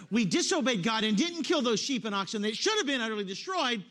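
loud, high mock self-justifying King Saul; mock boast. Preacher acting out Saul's excuse to the congregation, mock boast. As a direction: proclaim the lame excuse at full voice.

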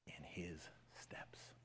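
very slow fading whisper; slow hushed whisper. Acted. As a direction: let the words die away in a whisper. Slowing to a whisper.

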